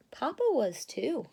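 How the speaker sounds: ordinary delivery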